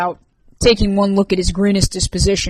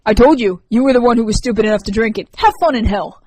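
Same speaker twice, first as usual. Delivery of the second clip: gloating taunt; 3 semitones higher, 7 dB louder than usual. Acted. Sam taunts sick Rourke.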